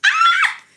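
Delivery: very high, loud giggly shriek; sudden high-pitched scream of glee; excited squeal. Real, not acted. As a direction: burst into a piercing squeal after laughing. Squeals after her own impression.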